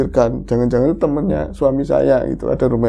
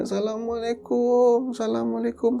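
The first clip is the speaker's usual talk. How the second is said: faint strained greeting; 8 semitones higher, 5 dB quieter than usual. Retold or imitated. Imitating a ghostly visitor's faint greeting outside the house. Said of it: "suaranya kayak lirih, terus kayak nahan beban".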